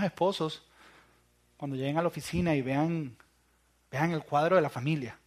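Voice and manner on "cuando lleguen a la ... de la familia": ordinary talk, nothing marked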